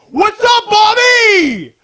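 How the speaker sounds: imitated locker-room scream; mock shout. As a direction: bellow a greeting across the room. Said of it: "just scream at each other".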